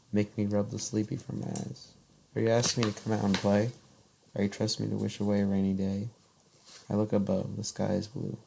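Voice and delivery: soft, fading poem recital; poem reading. Acted. Reads her poem aloud.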